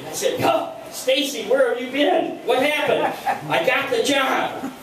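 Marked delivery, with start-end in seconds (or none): 0.4-3.1 higher-pitched excited questions; mildly excited, pitched up; retold eager questioning. Acts out eager questioning of Stacy. 3.5-4.7 high, drawn-out good news; slow, pitched-up excitement; retold excited announcement. Stacy announces her big news.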